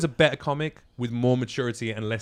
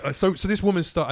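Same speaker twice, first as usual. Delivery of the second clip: usual speaking voice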